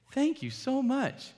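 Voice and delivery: exaggerated sweetness hiding annoyance; overly sweet thanks. Thanking the worker, exaggerated sugary politeness. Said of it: "I'm like".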